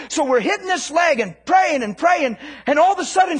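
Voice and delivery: loud, high, driving sermon delivery; fiery preaching. Acted. Preacher fires up congregation about commanding healing, loud and driving. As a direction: preach it hard to the crowd.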